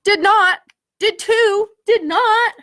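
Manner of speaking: a petty playground quarrel; childish bickering. Acted. Friends squabbling over the ripped letter, loud bickering.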